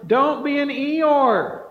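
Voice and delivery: rally the crowd out of gloom; loud exhortation, stretched out; rousing call. Real, not acted. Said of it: "Get excited".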